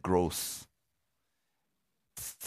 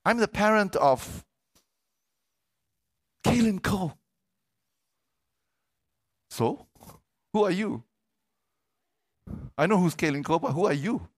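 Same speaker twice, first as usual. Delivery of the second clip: higher, louder strutting parent voice; boastful parent; 9 semitones higher, 3 dB louder than usual. Retold or imitated. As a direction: show off like a smug swim parent. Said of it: "you can sometimes see these parents like".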